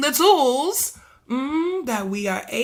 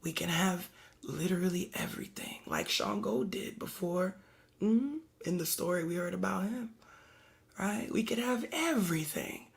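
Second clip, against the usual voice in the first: soft, hushed whisper; whispered preaching; 3 semitones lower, 9 dB quieter than usual. Acted. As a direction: draw them close in a whisper.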